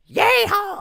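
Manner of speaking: very loud, high yeehaw shout; full-throated high whoop; demonstrated scream. Shown as a demonstration. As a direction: scream it out like a wild cowboy. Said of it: "as loud as I possibly could".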